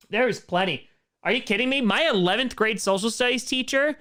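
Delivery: ordinary delivery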